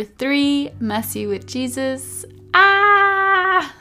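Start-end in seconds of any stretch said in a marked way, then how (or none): 2.5-3.7 high excited squeal. Real, not acted. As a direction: squeal with glee. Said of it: "I always geek out".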